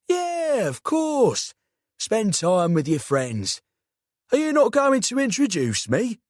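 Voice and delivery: high, teasing South London uncle; cheeky London uncle angling for introductions; South London accent. A narrator's reading. Uncle voiced in story.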